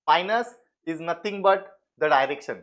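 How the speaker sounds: slow emphatic explanation. Shown as a demonstration.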